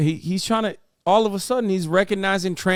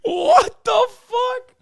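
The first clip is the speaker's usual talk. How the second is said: very high, slow drawn-out shocked cry; stunned cry; much slower than usual. Real, not acted. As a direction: disbelief stretched out. Shocked reaction.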